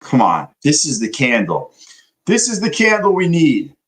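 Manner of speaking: ordinary delivery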